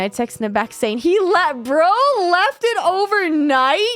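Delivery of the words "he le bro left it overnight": high, loud, slow incredulous question; can't believe he left it there; disgusted disbelief. Real, not acted. Disgusted by boyfriend.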